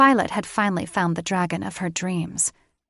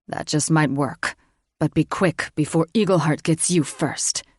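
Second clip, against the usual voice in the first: male voice; 2 semitones lower than usual. A narrator's reading. Thaddeus answers.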